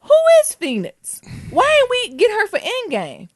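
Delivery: very high-pitched, incredulous questioning; indignant disbelief. Real, not acted. Fan asking co-host about Phoenix and Endgame, indignant disbelief.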